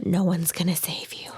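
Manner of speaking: eerie unvoiced whisper. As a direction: whisper it like a threat. Spooky whisper joke.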